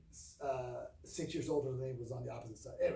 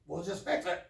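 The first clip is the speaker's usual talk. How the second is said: louder, higher curt command; gruff dad; 4 semitones higher, 7 dB louder than usual. Retold or imitated. Dad brushing off his daughter's problems, brusque. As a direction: imitate an impatient father dismissing it. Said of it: "just because he's like".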